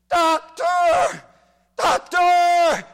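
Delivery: high, very loud screams for the doctor; desperate yell for a doctor; pained screaming woman. Retold or imitated. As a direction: scream it like she can't take the pain. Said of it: "Her scream sent chills up my spine".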